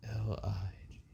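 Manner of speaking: sheepish whisper of the letters; whispered spelling. Real, not acted. Whispering the misspelling to her cohost, embarrassed. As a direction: whisper it like a confession. Spelling the name wrong quietly.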